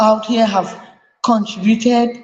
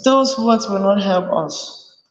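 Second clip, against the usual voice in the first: ordinary delivery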